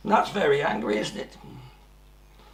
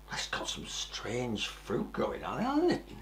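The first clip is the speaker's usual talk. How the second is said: whisper; 5 semitones lower, 8 dB quieter than usual. Acted. Pointing out strange fruit.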